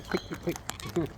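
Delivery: hushed quick urging; whispered coaxing. Real, not acted. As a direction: whisper urgently to coax. Coaxing bird.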